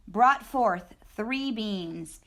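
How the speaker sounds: plain reading voice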